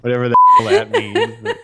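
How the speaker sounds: loud, high, helpless laughter through the words; laughing. Real, not acted. Co-host laughs at own tea remark, laughing loudly. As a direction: crack up while getting the line out.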